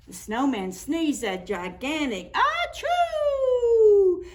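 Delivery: high, loud, slowed achoo sneeze; big sneeze. A narrator's reading. Narrator acts the snowman's giant sneeze, loud.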